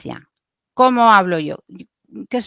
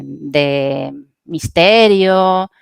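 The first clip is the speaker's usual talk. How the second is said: slow, louder mysterious hint; mysterious intent; 3 semitones lower, 4 dB louder, much slower than usual. Shown as a demonstration. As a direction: draw the word out as if telling a secret. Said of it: "Puede ser una intención".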